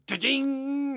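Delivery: high, ringing laser noise held long; high drawn-out sci-fi sound effect; imitated laser zap. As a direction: mimic a laser beam switching on. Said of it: "You know, it goes".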